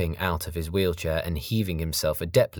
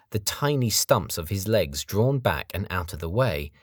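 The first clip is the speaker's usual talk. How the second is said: ordinary delivery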